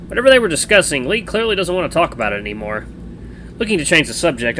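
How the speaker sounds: ordinary delivery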